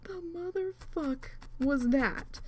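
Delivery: whispered, slow and high; stunned whisper. Retold or imitated. Gamer whispering in disbelief to her husband. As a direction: whisper it in shock, slowly. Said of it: "I was just".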